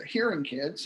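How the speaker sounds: ordinary talk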